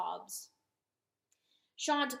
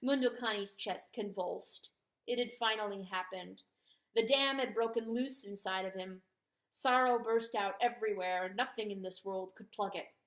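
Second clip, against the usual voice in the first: usual speaking voice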